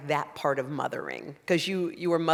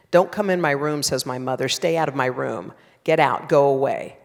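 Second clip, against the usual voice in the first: louder, cold stern orders; stern scary mother; 7 dB louder than usual. Acted. Mother ordering her scared daughter out of her room, stern and loud. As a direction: shut the child out, harsh and final. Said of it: "That's my mother's voice, stern, scary".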